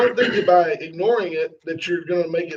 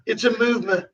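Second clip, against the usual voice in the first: higher-pitched boastful Trump voice; raised-pitch Trump impression, pausing between boasts; Trump impersonation; 4 semitones higher than usual. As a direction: bring it back round like Trump, self-congratulating. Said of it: "You gotta bring it back to him".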